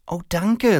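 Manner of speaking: very high, surprised woman; astonished thanks. A narrator's reading. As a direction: thank him in pleasant astonishment. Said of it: "sagt Frau Fuchs erstaunt".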